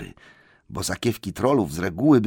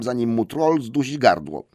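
plain reading voice

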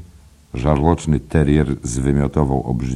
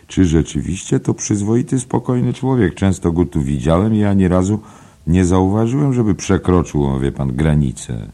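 a higher, doubting bartender's query; mildly surprised question; 7 semitones higher, 5 dB louder than usual. Acted. Byrne asking Flynn about Bloom, lightly raised and doubtful. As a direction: sound gently incredulous.

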